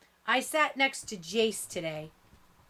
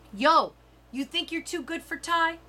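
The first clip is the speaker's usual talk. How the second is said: put-on tough girl challenge; 7 semitones higher than usual. A narrator's reading. Stephanie confronts her.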